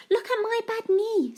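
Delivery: put-on high little girl voice; high-pitched child's "look"; child showing knee. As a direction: show off the knee like an excited child. Claire's repeated line.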